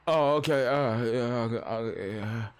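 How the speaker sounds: mock a stoned clerk, trailing into a murmur; dazed worker. Worker hearing a customer's complaint, dazed.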